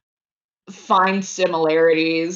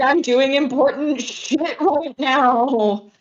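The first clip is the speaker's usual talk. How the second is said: higher, slow exasperated complaint; indignant; 6 semitones higher, slower than usual. Acted. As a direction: complain with mock dignity. Agatha shoved into snow.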